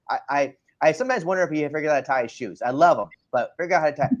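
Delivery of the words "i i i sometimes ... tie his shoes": ordinary talk, nothing marked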